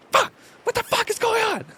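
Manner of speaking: high, strained outburst; burst of anger. Retold or imitated. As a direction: reenact a sudden comic meltdown, voice shooting up. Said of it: "little bursts of anger come out of me".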